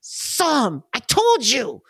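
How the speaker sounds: put-on high, excited child shouting he spotted you; little kid voice. GM voicing the kid who spots the frog, high excited child voice. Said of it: "you hear this little voice".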